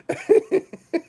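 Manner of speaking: real high, loud burst of laughter; squealing laugh at his own joke; helpless cackle. Real, not acted. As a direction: crack up, high-pitched. Cracking up at his own imitation.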